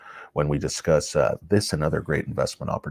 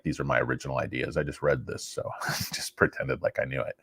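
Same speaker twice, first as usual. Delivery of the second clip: soft, fast whispered aside; whispering; 5 semitones lower, 8 dB quieter than usual. Real, not acted. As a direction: drop to a whisper. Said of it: "whispering monotone".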